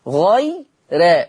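slow غي را with a thin, fronted "rae"; light ra. Shown as a demonstration. Teacher repeats Warsh's tarqiq, slow thin "rae" in غي را. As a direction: keep the ra of غي را light, toward "re". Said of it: "يقرأ بترقيق الراء".

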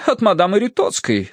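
high, assured matron; sure of it. A narrator's reading. Mistress guesses who sent the letter, confident. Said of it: "Это, без сомнения".

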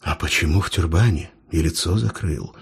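low, quiet skeptical questioning. A narrator's reading. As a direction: doubt every word, low. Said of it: "скептически спросил патрульный".

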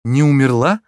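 startled echo with a questioning lift; astonished question. A narrator's reading. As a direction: ask it in amazement. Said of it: "с изумлением спросила девушка".

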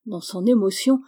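plain reading voice

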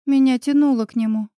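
plain reading voice

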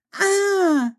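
high drawn-out wail of outrage; wailing shriek. A narrator's reading. Old ghost woman to the scolding doctor, wailing. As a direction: let out a long, high-pitched indignant howl. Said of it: "завопила виновница безобразия".

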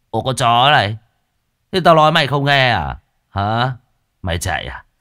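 bully her with a sneer; thug's threat. Acted. Thug threatens girl on road.

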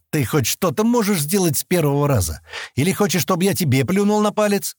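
high-pitched exasperated scolding; acted impatient outburst; angry. A narrator's reading. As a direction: scold with pitch climbing to the end. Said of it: "рассердился он".

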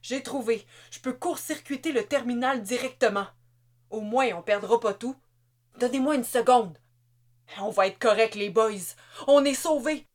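relieved triumph. A narrator's reading. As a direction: crow that they're saved.

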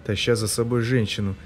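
plain reading voice

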